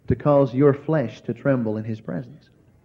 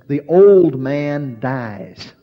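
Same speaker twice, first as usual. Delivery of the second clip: slow emphatic; 4 dB louder, much slower than usual. Acted. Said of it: "slow pronouncement".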